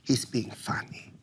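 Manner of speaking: very soft, dropping into a whisper; barely voiced, placating murmur as mom; hushed mom, reassuring. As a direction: imitate mom quietly soothing her child. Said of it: "And my mom was like".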